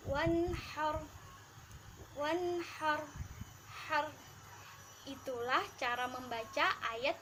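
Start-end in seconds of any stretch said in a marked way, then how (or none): 0.1-4.1 wanhar with a light breathy ha; thin ha. Shown as a demonstration. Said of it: "harus dibaca tipis".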